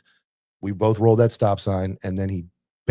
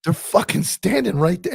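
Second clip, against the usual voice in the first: high, slow, exasperated disbelief; 9 semitones higher than usual. Retold or imitated. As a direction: spell out the absurd, stretched and incredulous. Exasperated at officer leaving suspects.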